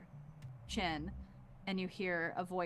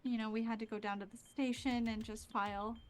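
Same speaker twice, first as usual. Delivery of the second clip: soft, higher, on the edge of tears; tearful mother; 3 semitones higher, 7 dB quieter than usual. Acted. Mother hugging daughter after police station, tearful. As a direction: apologize through held-back tears. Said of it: "her crying a little bit".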